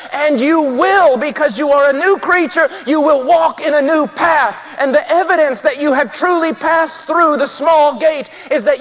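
emphatic, high-pitched sermon delivery; full-force preaching. Real, not acted. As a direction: drive each point home to the congregation, pitched high.

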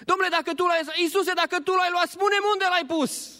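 very high, pressing plea; a grieving woman begging, pitched very high; distraught Maria. Acted. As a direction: demand an answer through grief. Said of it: "pentru ea Iisus era problema".

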